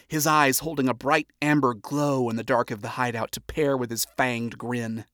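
usual speaking voice

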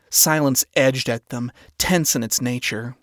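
usual speaking voice

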